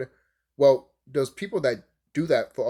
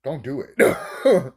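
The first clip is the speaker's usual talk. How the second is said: mock hesitation; 4 dB louder, much slower than usual. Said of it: "And I'm just like".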